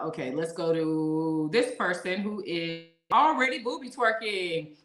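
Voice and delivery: ordinary talk, nothing marked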